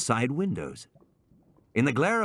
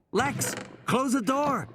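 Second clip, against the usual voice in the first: put-on urgent boy; 13 semitones higher, slower than usual. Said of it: "Tim urging sister".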